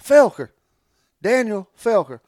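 high, loud, amazed repeat of the name; mock excited stranger; excited recognition. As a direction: blurt the name out, thrilled.

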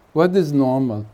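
ordinary talk, nothing marked

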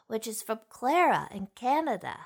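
plain reading voice

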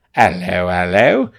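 slow, loud, swooping British accent; hammed-up British cabbie introducing himself, vowels stretched; second British accent. Shown as a demonstration. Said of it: "Two is like this".

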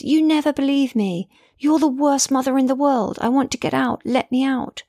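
high, screamed accusation; screaming. A narrator's reading. Daughter screaming at her mum in the car. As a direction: scream it at your mother. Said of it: "she screams".

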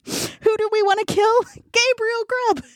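high-pitched mock goblin chant; calling goblin voices. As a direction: chant it high, drawing out the name. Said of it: "voices are calling".

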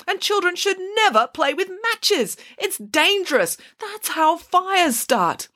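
high, emphatic telling-off; scolding. A narrator's reading. Hannah scolds the girl about matches.

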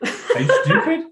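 high, laughing are-you-stupid; laughing mock. Dad voicing daughter's jab, laughing. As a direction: toss it off through a laugh. Mocking the son's reply.

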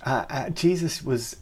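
ordinary talk

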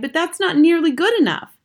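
put-on high, loud upset boy; loud boyish complaint; cried out. A narrator's reading. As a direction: argue it with rising dismay. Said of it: "I cried".

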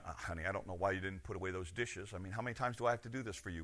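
quick, low, muttered nagging; nagging spouse. Retold or imitated. Spouse complaining about unwashed dishes, low and rushed. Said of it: "Instead of going".

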